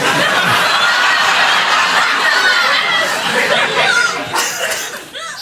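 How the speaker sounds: very high laugh; big laugh. Real, not acted. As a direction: crack up completely. Laughing mid story.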